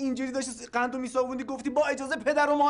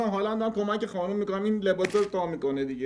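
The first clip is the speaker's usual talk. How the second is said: ordinary delivery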